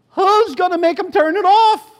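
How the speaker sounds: put-on high, loud old lady's shout; yelling again. Old lady shouting at the riders to stop the boom box. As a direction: demand it of everyone on the train. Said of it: "the old lady says again".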